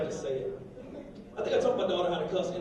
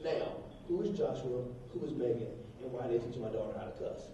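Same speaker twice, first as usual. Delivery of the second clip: restrained, pitched-down demand; quiet interrogation; 10 semitones lower, 13 dB quieter than usual. Retold or imitated. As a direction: question the teacher in a controlled hush. Father quietly questioning the teacher.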